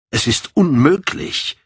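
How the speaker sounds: emphatic exclamation, pitch jumping up; exclaiming. A narrator's reading. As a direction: cry out in protest. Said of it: "rief er aus".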